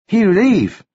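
high halting character voice; Arsuk. A narrator's reading. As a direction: answer briefly, pitched high.

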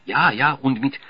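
German secretary chiming in; German accent. A narrator's reading. Secretary describing glasses to Roger, German accent. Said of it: "German accent, all right".

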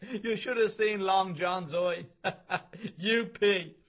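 high, slow, words squeezed out through laughter; helpless with laughing at the joke; can barely get the words out for laughing. Acted. Narrator in a fit of laughter.

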